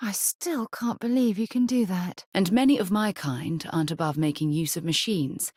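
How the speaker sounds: ordinary talk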